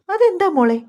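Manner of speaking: high, loud and startled; surprised question. Acted. Beena asking the young mother, loud, high and surprised. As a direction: blurt it out in sudden surprise. Beena asks why baby loves Marar.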